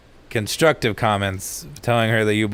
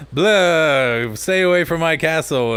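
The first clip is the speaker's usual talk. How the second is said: loud, higher Scooby-Doo monster yell; cartoon vampire; 4 semitones higher, 4 dB louder, slower than usual. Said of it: "the vampire comes out and he's all".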